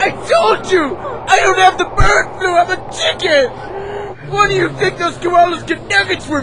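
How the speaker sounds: high-pitched indignant yelling; comic squawk of indignation, pitched high; exasperated protest. Acted. Exasperated protest at panicking crowd.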